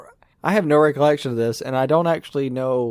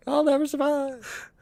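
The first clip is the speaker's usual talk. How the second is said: high, slow, hammed-up whine of doom; mock wail; 11 semitones higher, 4 dB quieter, slower than usual. Co-host mimicking the friend who was standing safely, mock wail. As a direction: draw it out in pretend despair. Repeats mock cry of the climber.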